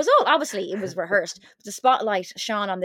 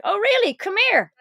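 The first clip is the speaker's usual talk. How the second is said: mock challenge; 10 semitones higher, 4 dB louder than usual. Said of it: "She's like".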